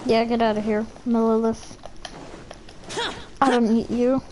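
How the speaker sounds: normal talking voice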